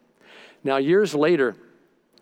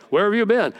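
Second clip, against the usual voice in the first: higher, louder mock-suspicious question; mock suspicious wife; 7 semitones higher, 4 dB louder than usual. Pastor imitating his suspicious wife at the door. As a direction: play the jealous wife asking, tongue in cheek.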